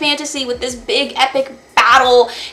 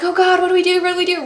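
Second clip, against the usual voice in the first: lightly voiced panic, pitched well higher; mock panic; 8 semitones higher, much faster than usual. Feyre panicking at the cauldron.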